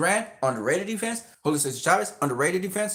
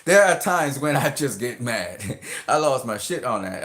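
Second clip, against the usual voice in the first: usual speaking voice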